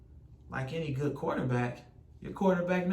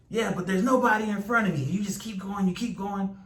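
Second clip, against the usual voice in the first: urging on; 6 semitones higher, 5 dB louder than usual. Acted. Acts out the inner urging voice.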